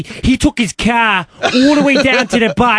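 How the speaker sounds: drawn-out, exaggerated Boston accent; heavy Boston accent. Co-host mocking an actor's movie accent, heavy Boston. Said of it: "he had this very heavy Boston accent".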